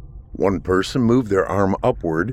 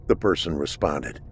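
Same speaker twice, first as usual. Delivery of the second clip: usual speaking voice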